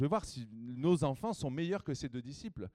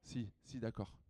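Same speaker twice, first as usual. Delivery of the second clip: quiet aside; 4 semitones lower, 8 dB quieter than usual. Real, not acted. Quiet aside while choosing someone.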